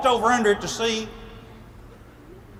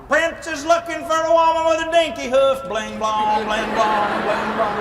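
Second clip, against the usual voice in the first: town crier call. Retold or imitated. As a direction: proclaim it to the village. Town crier announcing the search.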